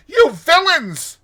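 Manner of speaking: high, slow, very loud yell; furious shout. A narrator's reading. Rat transported with passion at the motorists, furious high shout. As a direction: bellow it in a high-pitched rage. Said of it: "he shouted".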